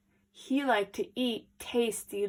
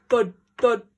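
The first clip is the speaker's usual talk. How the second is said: heavy knocks; 9 dB louder than usual. A narrator's reading. Knocking sound effect.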